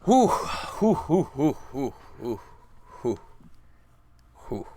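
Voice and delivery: high loud panting whews fading down; relieved puffs. Real, not acted. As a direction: blow out relief, then settle. Relief after winning.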